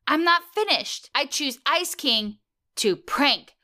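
high, lightly put-on robot son, slowing down; a light mock robot voice, building to a punchline. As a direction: play the little robot announcing his choice with glee. Robot son quoted in recap.

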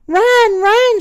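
high, loud warning shout. A narrator's reading. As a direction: shout the alarm to flee. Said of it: "shouted Mom".

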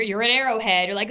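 ordinary delivery

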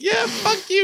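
high-pitched squeaky mock retort; exaggerated high-voiced imitation of an angry reply; mock argument. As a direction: act out a heated comeback in a very high voice. Acting out a retold argument.

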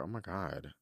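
ordinary delivery

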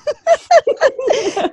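high burst of laughter; loud shrieking laugh. Real, not acted. Laughs at silly remark.

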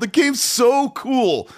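high-pitched, thrilled gushing; gushing enthusiasm. Real, not acted. Telling the co-host about roaming the open world, gushing.

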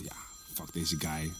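zacht en traag; binnensmonds, langzaam uitgesproken; zacht gemompeld. Retold or imitated. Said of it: "En hij zei". (zachtjes gemompeld citaat)

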